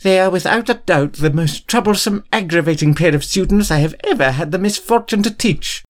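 high, indignant complaint about the twins; exasperated rant. A narrator's reading. McGonagall to Remus about the twins, indignant. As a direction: fume at those students. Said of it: "angry movement".

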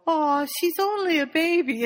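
high-pitched aww, fawning; acted cooing. Voices cooing over baby dragon.

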